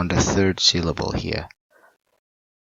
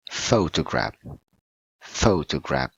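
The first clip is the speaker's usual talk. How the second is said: "photograph" with the beat on "pho", then falling away; stress on first syllable; 3 semitones lower than usual. Shown as a demonstration. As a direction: punch the opening "pho" of "photograph". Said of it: "with the correct stress word".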